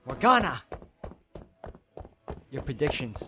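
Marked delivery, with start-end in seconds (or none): 0.1-0.6 shouted out loud, pleading; desperate shout. Acted. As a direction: yell her name into the silence. Mikkel calling to silent Morgana.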